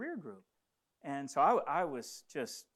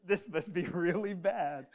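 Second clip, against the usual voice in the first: retold thought broken by a laugh; amused dread; 3 semitones higher, 5 dB louder than usual. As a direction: laugh lightly through the recalled worry. Laughs recalling his own dread.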